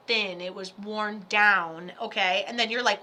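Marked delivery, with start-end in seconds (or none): none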